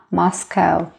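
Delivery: usual speaking voice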